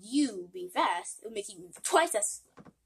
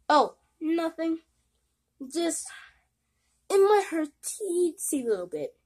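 sheepish; 7 semitones higher, 4 dB louder than usual. Acted. Hiding painful truth, sheepish.

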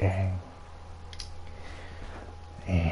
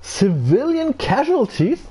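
high, slow, disbelieving; high drawn-out outrage; incredulous question; 11 semitones higher than usual. Real, not acted. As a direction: stretch it out as if it can't be true. Disbelief at penalty message.

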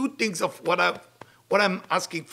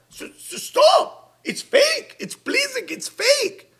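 exasperated scolding; 5 dB louder, much slower than usual. Real, not acted. Rabbi scolding people for fake apologies, high and exasperated. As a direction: voice jumps way up, slow, louder on "stop".

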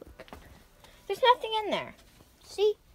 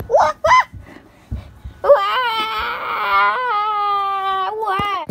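put-on baby wailing; 4 semitones higher, 5 dB louder, much slower than usual. Baby toy cries at prank.

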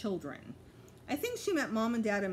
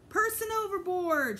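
high loud alarmed shout; 11 semitones higher, 6 dB louder than usual. A narrator's reading. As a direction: yell it out across the water. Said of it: "Sheila shouted".

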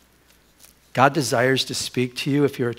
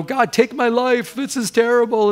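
higher-pitched mock despair, no real sobs; retold wail to God, lightly acted; imitated crying plea; 9 semitones higher than usual. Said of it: "And he's crying".

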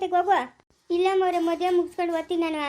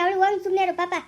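usual speaking voice

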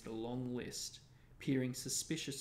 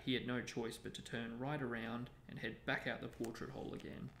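ordinary delivery, nothing marked

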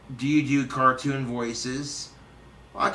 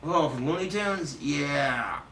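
ordinary talk, nothing marked

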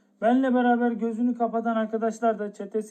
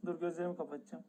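hushed nerves; 4 semitones lower, 14 dB quieter, much faster than usual. Real, not acted. Nervous, closing eyes.

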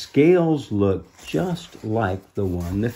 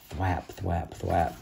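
quiet low wing thwaps; soft flapping sounds; 3 semitones lower, 7 dB quieter, slower than usual. Shown as a demonstration. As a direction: mimic distant wingbeats. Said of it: "More onomatopoeia".

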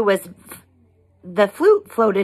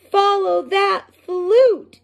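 group yell; 12 semitones higher, 4 dB louder than usual. Acted. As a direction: call out to chase the flute. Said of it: "yelled Fifi, Frog".

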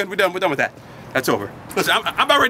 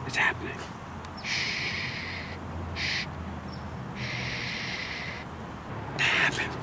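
whispering; 16 dB quieter than usual. Acted. Hushed suspense before the kiss.

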